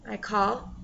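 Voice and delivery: ordinary delivery, nothing marked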